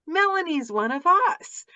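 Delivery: high, loud delighted outburst, drawn out; retold excited exclamation. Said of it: "I'm like".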